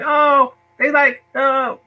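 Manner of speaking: put-on high, exasperated kid groan.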